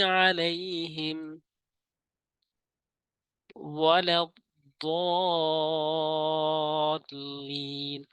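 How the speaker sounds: slow, drawn-out chanted recitation; Quran recitation. Shown as a demonstration. Teacher recites the verse for viewers, slow and chanted. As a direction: stretch each long vowel like tajweed.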